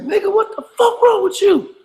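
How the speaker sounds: high, sharp mock yell of an angry cop. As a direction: yell it like a cop who just got cut off. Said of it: "He said his exact words to me".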